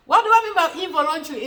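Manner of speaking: asked quickly through a laugh; amused, quick rhetorical question. Real, not acted. Teacher repeats question, laughing.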